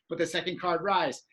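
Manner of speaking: ordinary talk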